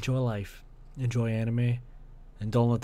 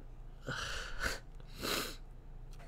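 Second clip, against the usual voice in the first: long unvoiced sigh; a slow exhale of grief; grieving sigh; 11 dB quieter than usual. Real, not acted. Mourning thirty-three dead.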